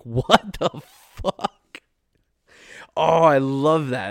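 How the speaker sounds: laughing out the words, stretched slow; cracking up in disbelief. Real, not acted. Host reacting to a listener's story, laughing in disbelief.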